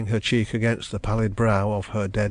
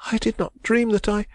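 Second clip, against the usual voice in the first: put-on distressed young woman; 13 semitones higher than usual. A narrator's reading. Young woman protesting her love, high and anguished. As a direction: cry it out as the wronged heroine. Heroine's voice.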